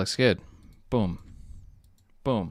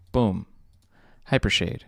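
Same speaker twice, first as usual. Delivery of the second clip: ordinary talk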